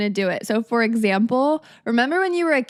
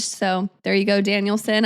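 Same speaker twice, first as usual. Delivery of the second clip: ordinary delivery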